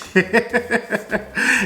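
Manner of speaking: a sudden high cackle at his own joke; high cackling laugh. Real, not acted.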